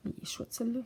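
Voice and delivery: fond little whisper. Real, not acted. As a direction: whisper it like a secret. Whispered aside about the book. (chuchoté avec tendresse)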